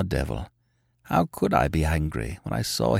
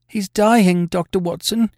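higher, urgent landlady voice; distressed landlady; 7 semitones higher, 3 dB louder than usual. A narrator's reading. Landlady telling Watson of her dying lodger, distressed. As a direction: plead as a worried housekeeper.

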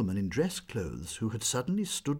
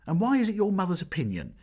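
high, loud pressing question; eager question; 10 semitones higher, 5 dB louder than usual. Acted. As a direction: press her for more. Curious girls press Meg.

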